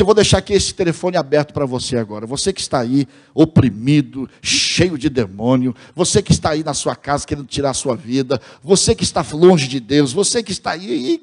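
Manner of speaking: impassioned radio-evangelist appeal; imitating a fervent preacher addressing listeners; tearing into the word. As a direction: build an urgent altar call, drawing out key words. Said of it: "e rasgava o verbo".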